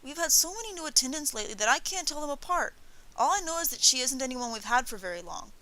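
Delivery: put-on high-pitched sulky child; boyish, peevish complaint. A narrator's reading. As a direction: complain like a sulking youngster. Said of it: "Yoshiteru looked sulky".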